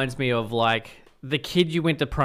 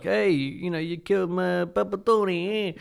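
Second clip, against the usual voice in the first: higher-pitched, drawn-out mock mobster voice; mafia gangster; 6 semitones higher than usual. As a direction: put on a movie mobster, pitched up and stretched out. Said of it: "the mafia come and be like".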